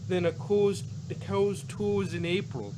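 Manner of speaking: a high, hammed-up Minnesota folksy voice; mock Minnesota accent. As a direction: play a folksy Minnesotan swapping weather sayings. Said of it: "Minnesota accent".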